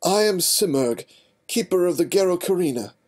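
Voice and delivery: high, loud, grand regal proclamation; royal voice. Acted. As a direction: hold a haughty queenly air. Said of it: "her best rendition of a royal voice".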